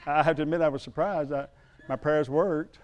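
ordinary talk, nothing marked

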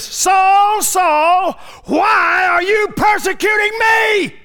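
booming, high divine call; voice of the Lord. Acted. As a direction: thunder it out like the Lord from heaven. Jesus calls out to Paul.

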